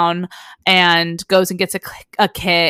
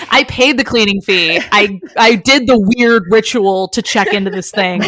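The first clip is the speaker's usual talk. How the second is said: uncle's indignant retort; 2 semitones higher, 6 dB louder than usual. Retold or imitated. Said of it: "And he says".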